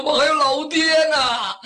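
high, sustained pleading call; pleading shout. A narrator's reading. Captive begs his chief for his father's sake, high pleading shout. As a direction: cry out high, begging for your life. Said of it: "齐声这么一喊".

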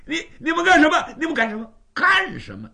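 startled captive's frantic protest; panicked protest. Acted. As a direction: sputter it out like a man just tackled. Captured bandit panics when seized.

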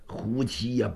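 usual speaking voice

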